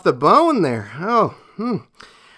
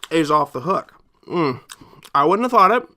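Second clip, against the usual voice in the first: normal talking voice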